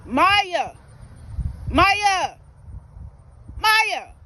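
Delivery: loud, rising calls across the yard; calling the dog. Real, not acted. Owner calling her dog Maya across the field, loud.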